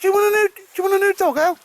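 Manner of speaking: very high, loud squeaky pup voice; dog's voice. Pretend. Owner voicing his dog Baldrick to Al, high squeaky dog voice. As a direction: speak for the dog in a squeaky falsetto. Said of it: "He says".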